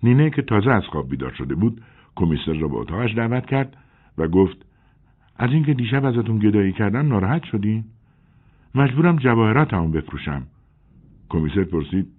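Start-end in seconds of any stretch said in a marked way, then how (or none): 5.4-10.4 lightly voiced young woman, pitched higher; a hint of an anxious young woman. A narrator's reading. Nine's voice to the commissioner. (صدای زن جوان، کمی بالاتر)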